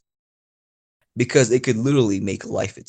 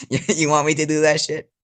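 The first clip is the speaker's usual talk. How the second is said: ordinary talk, nothing marked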